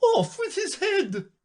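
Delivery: high, slow shouted sentence; shouting. A narrator's reading. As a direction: bellow the order like a queen.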